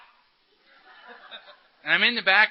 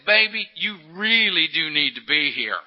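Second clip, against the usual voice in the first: tender plea; 4 dB louder, slower than usual. Retold or imitated. Wife comforting him tenderly.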